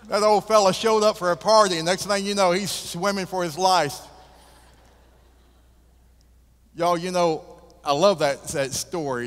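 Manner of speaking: loud, high punchline delivery; booming preacher savoring the joke; full-force preaching. Acted. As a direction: drive the laugh home to the congregation.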